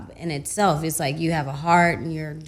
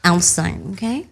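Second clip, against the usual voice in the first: mock threat; 2 semitones higher, 9 dB louder, slower than usual.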